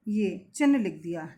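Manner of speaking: ordinary talk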